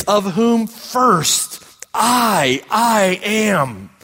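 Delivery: very slow, weighty "of whom first I, I am" with a beat on each word; emphatic Greek word order. Shown as a demonstration. Preacher reading Paul's words to the congregation, slow and weighty. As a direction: lay each word down slowly and heavily. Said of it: "And so literally in the Greek".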